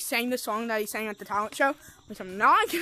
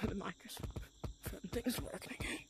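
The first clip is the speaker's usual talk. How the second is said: whisper; 4 semitones lower, 16 dB quieter than usual. Real, not acted. Whisper after mic fix.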